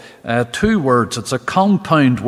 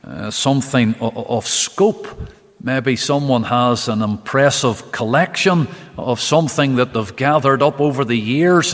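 usual speaking voice

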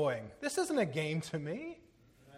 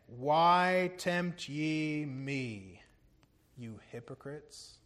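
sobering rebuke; 3 semitones lower, much slower than usual. Retold or imitated. Preacher quoting Jesus rebuking hypocrites, slow and sobering. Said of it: "Man, that's sobering".

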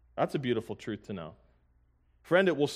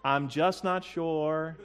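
mock wishful prayer; 4 semitones higher, slower than usual. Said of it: "You're not just singing hopeful, wishful things".

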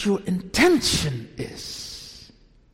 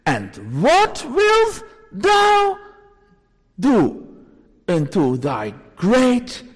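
solemn scripture quote; 2 semitones higher, 8 dB louder, slower than usual. Acted. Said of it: "I will quote".

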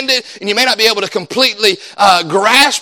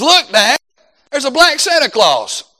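higher-pitched imitation of an excited child; young son; 5 semitones higher than usual. Son spotting Santa ornaments at Walmart, imitated child. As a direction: point it out to Dad like a kid. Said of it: "and my son, he says".

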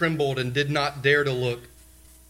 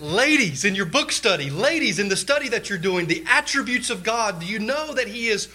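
raised, urgent preaching voice, pitched higher and louder; full-force preaching; 8 semitones higher, 4 dB louder than usual. Acted. Preacher urging the ladies toward God's holiness, fervent. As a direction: drive it home from the pulpit.

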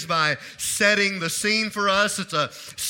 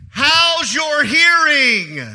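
slow, loud, higher-pitched shout; loud demonstration; 5 semitones higher, 8 dB louder, much slower than usual. Shown as a demonstration. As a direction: shout it slowly, as if to someone hard of hearing. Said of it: "let me say it like this".

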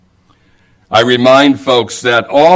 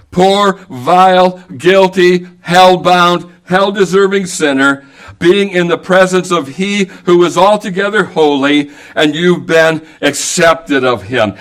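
preaching; 4 semitones higher than usual. Acted. Preacher's rising appeal to sinners.

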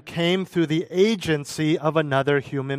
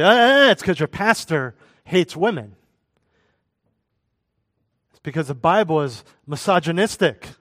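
scoffing mock critic, louder; brushing off with a scornful eh eh eh; mock objector; 2 semitones higher, 5 dB louder than usual. Preacher mocking critics who blame him.

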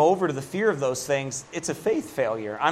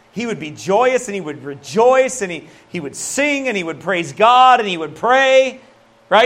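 loud, high, rising preacher's cadence; preaching at full force; 6 semitones higher, 7 dB louder than usual. Acted. Preacher rousing the congregation, full-force preaching. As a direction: preach it with building fervor. Preaching about Christ's joy.

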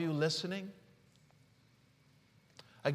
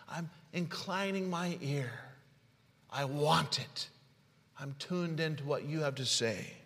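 soft prayer; 3 semitones lower, 7 dB quieter than usual. Acted. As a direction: whisper your surrender to the Lord. Quiet prayerful listening to God.

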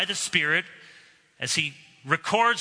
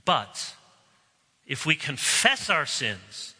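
closing prayer; 3 semitones lower than usual. Acted. Pastor leads the congregation in a closing prayer, earnest. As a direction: pray it steadily and humbly.

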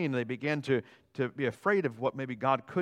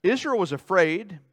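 usual speaking voice

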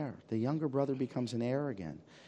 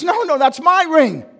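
high, loud "No, no, that's my ring"; indignant outburst; 15 dB louder than usual. Acted. Elder son bursting out over the ring, high and indignant. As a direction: protest as the jealous elder son. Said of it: "He says".

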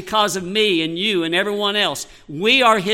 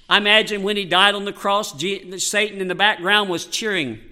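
normal talking voice

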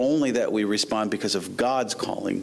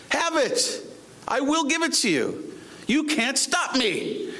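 high-pitched, insistent preaching; impassioned God's voice; 10 semitones higher than usual. Acted. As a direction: declare it as God, brooking no argument.

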